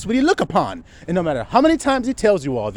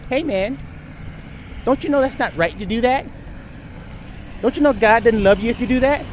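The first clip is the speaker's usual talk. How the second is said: higher, sugary preachy nagging; mock sweet scolding; 5 semitones higher than usual. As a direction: play a sanctimonious busybody feigning concern. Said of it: "They said".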